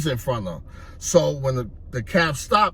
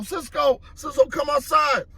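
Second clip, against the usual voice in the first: imitated calling out; 12 semitones higher than usual. Hustler calling his partner outside, imitated high-pitched call. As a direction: call him out of the building. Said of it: "So I called Cisco".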